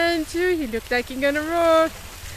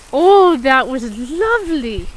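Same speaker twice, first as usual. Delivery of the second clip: loud delighted praise; 2 semitones lower, 7 dB louder than usual. Real, not acted. Praising horse after hug.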